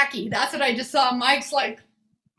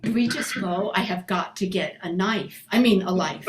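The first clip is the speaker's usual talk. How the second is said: words pushed through a clenched jaw; tight-jawed talking; 5 semitones lower than usual. Shown as a demonstration. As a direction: talk without moving your mouth, resentful. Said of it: "you start talking like this".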